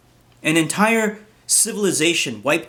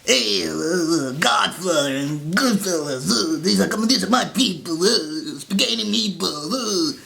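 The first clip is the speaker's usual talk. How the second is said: a sneering fake Jersey Italian accent; mock Italian American accent; 2 semitones higher than usual. Mocking him with a put-on Italian American accent. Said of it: "with that stupid bullshit accent".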